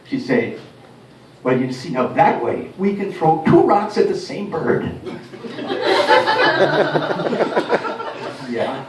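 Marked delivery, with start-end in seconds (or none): 1.4-4.9 slow, amused Irish brogue; Irish mum brogue with a chuckle. Retold or imitated. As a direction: deliver it slowly like Irish folk wisdom. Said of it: "with an Irish brogue".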